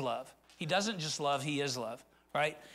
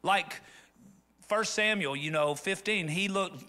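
ordinary delivery

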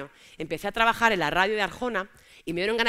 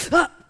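1 imitated yelp; 6 semitones higher, 9 dB louder than usual. Boyfriend's reaction to slap.

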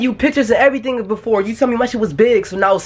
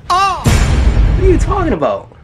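slow offended disbelief; 8 semitones higher, 4 dB louder, much slower than usual. Acted.

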